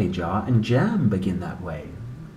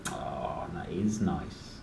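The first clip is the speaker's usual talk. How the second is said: hushed; 7 semitones lower, 11 dB quieter, slower than usual. Acted. Soft hushed comment.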